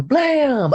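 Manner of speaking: high, loud mock gunshot; kid-style gun noise; gunshot blam. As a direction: bark it like a shotgun blast. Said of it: "gunshot sound effect".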